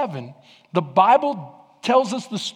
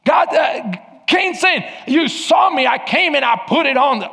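higher, louder shouted preaching; preaching at full force; 8 semitones higher, 6 dB louder than usual. Acted. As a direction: preach it hard.

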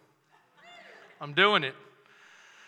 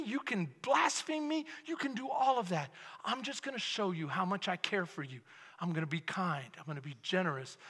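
softer, gentle promise of kindness; tender Jesus voice; 3 semitones lower, 7 dB quieter than usual. Acted. Preacher to congregation, voicing Jesus, soft and tender. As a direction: lay each promise down quietly and tenderly.

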